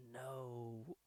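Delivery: low, very soft, drawn-out reply; blank answer. Retold or imitated. Savage Dragon answering the cop's question, quiet blank reply. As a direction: let it out quietly after thinking. Amnesiac hero's delayed answer.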